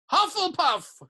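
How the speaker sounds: high, loud announcing shout; hat's shout. A narrator's reading. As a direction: call the house out proudly to the hall. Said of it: "shouted the hat again".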